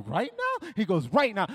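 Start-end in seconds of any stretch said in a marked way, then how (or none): 0.1-0.7 high, disbelieving question; acted disbelief, voice shooting up; incredulous question. Balks at God's order. 1.1-1.5 loud, insistent order; acted no-argument insistence; firm command. God repeats the order firmly.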